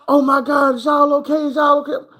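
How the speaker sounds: high, loud worried lady voice; rushing over, fussing; concerned bystander. Retold or imitated. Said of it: "the folks come back".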